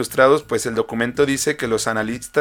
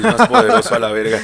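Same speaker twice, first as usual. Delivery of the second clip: high, laughing mock boast; 10 semitones higher, 5 dB louder than usual. Real, not acted. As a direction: laugh through the words.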